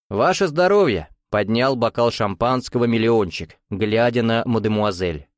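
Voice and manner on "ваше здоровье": raised-pitch, exclaimed toast; hearty toast. A narrator's reading. Millionaire raises his champagne glass to the mademoiselle, hearty. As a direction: toast her with gusto.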